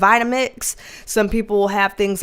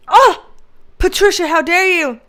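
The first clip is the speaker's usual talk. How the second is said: higher, loud gasped reproach; mock outrage; 6 semitones higher, 5 dB louder than usual. Host scolding a viewer who is making tamales, mock scandalized. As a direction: scold playfully, as if personally wronged.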